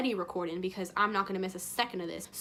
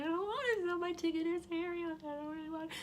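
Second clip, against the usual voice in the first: high pleading whine trailing off softer; mock whiny protest; 7 semitones higher, 4 dB quieter than usual. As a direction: whine like a kid refusing. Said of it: "And I'm like".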